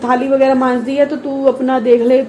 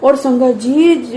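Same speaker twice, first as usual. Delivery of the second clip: normal talking voice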